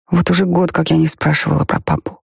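usual speaking voice

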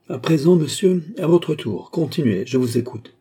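a dry cold challenge. A narrator's reading. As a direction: drop the courtesy, go cold as an adversary. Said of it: "le ton sec et froid de l'adversaire".

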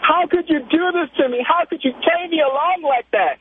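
high-pitched mock outrage; angry, imitated. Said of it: "then she got angry".

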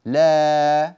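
"لا" held long and loud on the alif; long drawn la. Shown as a demonstration. Teacher showing students the long alif sound in "لا". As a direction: stretch "لا" out slowly. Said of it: "هذا الصوت الآن هو صوت الألف".

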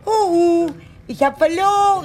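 high and loud, giddy; calling out. Acted. Aunt calls to Roberto that she lost, loud high giddy. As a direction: shout happily across the tables.

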